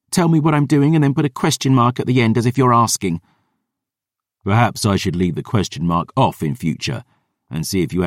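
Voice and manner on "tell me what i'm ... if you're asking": put-on young woman's voice, high and quick, exasperated; higher, faster girl's voice, irritated; Dior. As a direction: snap it out fast, fed up with him. Dior's exasperated complaint.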